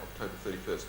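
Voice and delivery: normal talking voice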